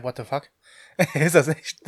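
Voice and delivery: normal talking voice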